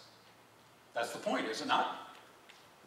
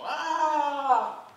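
long, high, loud scream; hammed-up howl at the sudden light; drawn-out "aah" yelled high; mock scream; 11 semitones higher, 7 dB louder than usual. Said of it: "They start shouting at you".

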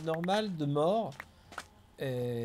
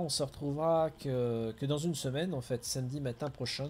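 ordinary talk

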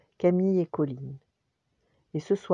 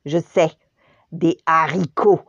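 louder fed-up shout; exasperated yell; 8 dB louder than usual. Acted. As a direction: snap it out in exasperation. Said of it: "hurla Camille exaspérée".

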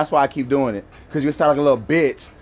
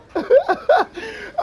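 a sudden high cackle of a laugh; high burst of laughter. Real, not acted. Laughing at the rival he is taunting, high cackle. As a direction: break into a high-pitched laugh.

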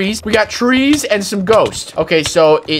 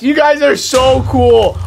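loud, slow, delighted call; excited shout; 6 semitones higher, 3 dB louder than usual. Real, not acted. Excited shout at the creepers. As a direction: cheer them on, thrilled.

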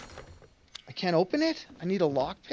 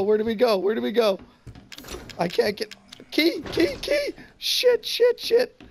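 high, loud panicked yelling; panicked screaming; 11 semitones higher, 9 dB louder than usual. Real, not acted. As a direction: scream it, running for your life. Chased by the killer, panicking.

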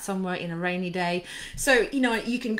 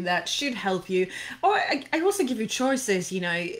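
normal talking voice

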